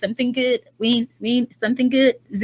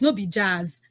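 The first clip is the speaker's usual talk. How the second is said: soft answer; 4 semitones lower, 5 dB quieter than usual. Shown as a demonstration. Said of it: "the answer".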